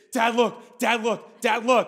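mock excited little boy calling dad; a touch of a thrilled toddler; excited kid.

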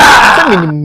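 high, loud outcry; a loud half-wailing shout; loud cry. Real, not acted. Excited overlapping exchange.

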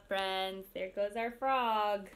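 ordinary talk

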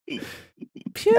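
high, breathy squeal; excited yelp. Real, not acted. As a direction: yelp it out in delight. Chaos erupts in the call.

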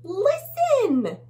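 high, loud little bug cry; tiny bug. Acted. Tiny bug alerting its friends, high and urgent. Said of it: "says this tiny bug".

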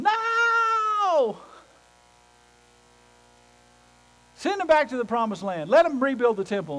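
a loud, high cry of "No!" then urgent pleading; protesting Israel. Retold or imitated. Israel protesting at being sent home, high alarmed cry. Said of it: "And they're going".

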